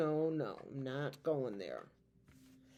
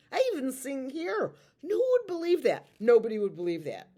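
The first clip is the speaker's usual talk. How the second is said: excited; 9 semitones higher, 8 dB louder, faster than usual. Real, not acted. Excited claim about singing.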